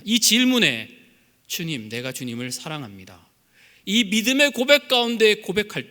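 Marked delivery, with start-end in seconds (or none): 1.5-3.3 soft confession. Acted. Believer's confession voiced softly.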